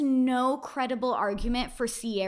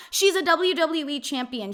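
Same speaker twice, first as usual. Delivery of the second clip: ordinary delivery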